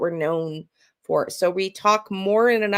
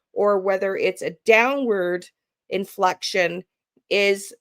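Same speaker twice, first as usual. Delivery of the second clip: usual speaking voice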